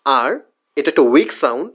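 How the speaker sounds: ordinary talk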